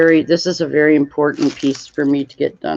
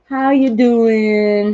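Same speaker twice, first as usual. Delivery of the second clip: 1 drawn-out greeting; 6 semitones higher, 4 dB louder, much slower than usual. Real, not acted. Greeting viewer in chat.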